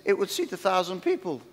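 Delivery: normal talking voice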